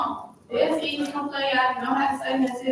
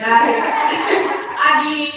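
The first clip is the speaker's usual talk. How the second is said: laughing admission; 4 semitones higher, 7 dB louder, much slower than usual. Real, not acted. Laughing admission about drinking.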